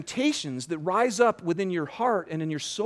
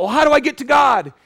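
raised, high-pitched cry of a seeker; loud urgent question; 8 semitones higher, 10 dB louder than usual. Acted. Preacher voices a seeker far from God, loud and urgent.

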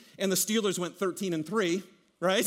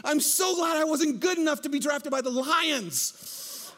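overjoyed relief; 7 semitones higher, slower than usual. Retold or imitated. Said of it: "they're like".

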